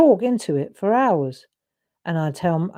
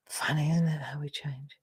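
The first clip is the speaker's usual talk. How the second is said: soft whispered aside, much softer; 3 semitones lower, 10 dB quieter than usual. Real, not acted. Musing to viewers while drawing, whispered. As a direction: breathe it out, musing to yourself.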